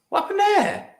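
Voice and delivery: sudden high, baffled question; surprised. Real, not acted. Streamer startled by a viewer's message, high and baffled. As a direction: jump up in pitch, genuinely thrown.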